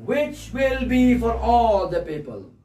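slow, ringing preacher's declaration; scripture proclamation. Real, not acted. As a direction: draw the verse out for everyone.

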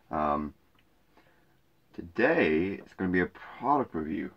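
ordinary talk

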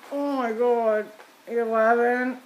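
usual speaking voice

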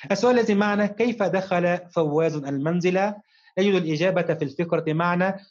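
ordinary talk

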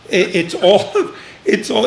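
normal talking voice

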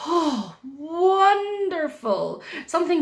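high, drawn-out swoon; delighted wonder. Acted. Bee catching a lovely scent, swooning with delight. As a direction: savour it slowly, high and swooning.

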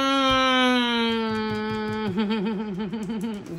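long held hum breaking into a stuttering laugh; long hum into giggle. Real, not acted. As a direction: hold in the words, then let it shake into giggles. Holds back what she'd say.

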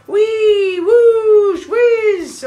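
high, loud gleeful cries of the sliding snowman; whee. Acted. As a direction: whoop it out like sliding down a slide.

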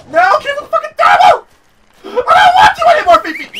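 very high, loud laughing yell; laughing furious shout. Real, not acted. Giving up on Fifi, laughing furious scream. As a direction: rage at the mount through laughter.

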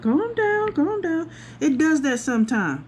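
high, hammed-up soothing voice; calm her down, playfully; soothing worker.

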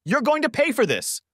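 very high-pitched angry threat; narrator's strained-up yell; restrained shout. A narrator's reading. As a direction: shout it high, holding back the volume. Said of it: "he shouted again".

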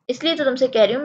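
usual speaking voice

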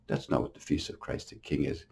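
normal talking voice

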